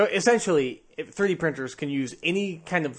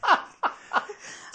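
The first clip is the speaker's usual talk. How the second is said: laughing. Real, not acted. Laughs after own joke.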